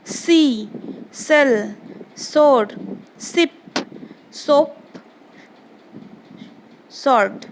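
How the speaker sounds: each of सी, सेल, सोर begun with a clean hissing S; S sound demonstration. Shown as a demonstration. Teacher showing pupils how to read S words, सी to सोप each with a clear S. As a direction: model a plain S, not SH, in सी through सोप, word by word. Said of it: "एस में कैसे pronounce करेंगे".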